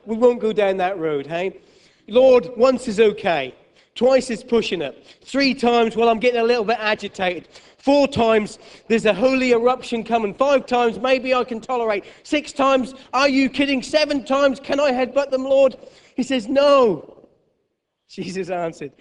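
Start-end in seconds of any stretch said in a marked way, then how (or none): 2.1-15.8 high, loud, building exasperation; hammed-up disciple losing patience; exasperated Peter. Escalating count of forgiveness. 16.5-17.3 loud high firm no; mock Jesus no. Jesus answers.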